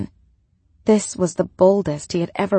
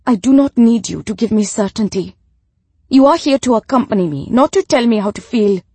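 loud, higher, indignant widow; sharp rebuke; 4 semitones higher, 9 dB louder than usual. A narrator's reading. Widow tells off her hired companion, sharp rebuke. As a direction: scold loudly, putting the companion in their place. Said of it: "the widow snapped sharply at Rebecca".

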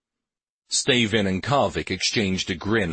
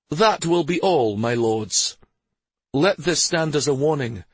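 higher, forceful royal ultimatum; stern Stavin warning lords; 7 semitones higher than usual. Acted. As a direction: issue a firm warning as a ruler.

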